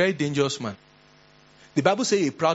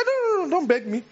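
much higher, defiant refusal; proud challenge; 6 semitones higher than usual. Retold or imitated. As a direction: dare them to a contest.

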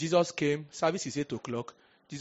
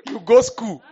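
loud mocking shout; 8 semitones higher, 13 dB louder, slower than usual. Shown as a demonstration. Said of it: "You speak grammar".

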